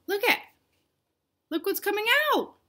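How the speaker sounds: high, quick, thrilled "look"; excited gasp. Acted. Reader gasping to children as things spill out. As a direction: pull the kids in with wonder.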